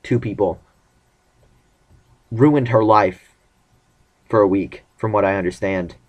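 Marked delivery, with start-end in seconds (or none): none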